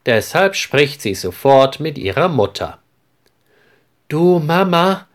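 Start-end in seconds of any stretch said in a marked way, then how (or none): 4.1-5.1 high, bright-eyed eager call. A narrator's reading. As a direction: call her mother full of eager hope. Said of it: "sagt sie mit leuchtenden Augen". (freudig aufgeregt, deutlich höher)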